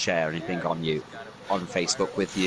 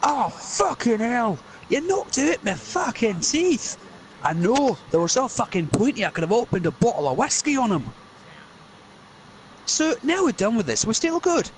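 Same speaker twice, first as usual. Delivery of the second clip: pained Scottish voice; 12 semitones higher than usual. Acted. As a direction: moan about lost teeth. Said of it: "there's gonna be like".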